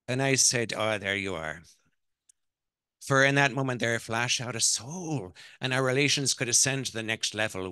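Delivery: grand Irish matriarch telling an anecdote; Irish accent. Acted. Mother tells her son's wife about his childhood, Irish accent. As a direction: recount it theatrically in an Irish accent. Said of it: "that's my Irish accent".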